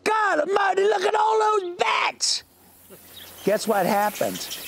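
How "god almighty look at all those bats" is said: very high startled yell; excited shout. Retold or imitated. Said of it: "Jake goes".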